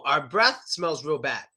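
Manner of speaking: ordinary talk, nothing marked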